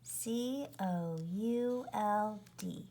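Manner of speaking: spelled out C O U L D letter by letter; letter-by-letter spelling; spelling aloud. Shown as a demonstration. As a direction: say C O U L D as separate letters. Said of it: "could is a heart word".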